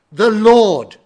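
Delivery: "The Lord" boomed out high and loud, then a pause; stress on LORD. Shown as a demonstration. Preacher demonstrating emphasis on different words of a text, "Lord" heavily stressed. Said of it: "put an emphasis on different words".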